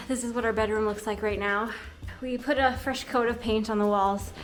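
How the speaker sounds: normal talking voice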